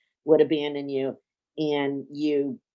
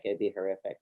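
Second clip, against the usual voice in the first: quiet echo; 7 semitones lower, 10 dB quieter, faster than usual. Shown as a demonstration. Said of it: "coach repeats client's words".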